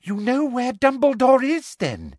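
high, greedy questioning; eager. A narrator's reading. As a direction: press eagerly for the answer. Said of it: "said Umbridge eagerly".

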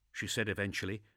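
plain reading voice

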